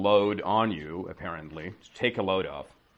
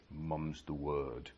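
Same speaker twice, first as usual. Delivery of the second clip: slow, quiet British promise of secrecy; British accent; 6 semitones lower, 12 dB quieter, much slower than usual. Retold or imitated. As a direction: softly promise to keep it secret, British style. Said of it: "in British accent".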